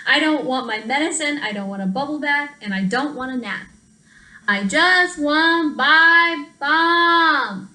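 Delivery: whiny plea. Acted. Translated whine, stuffy child.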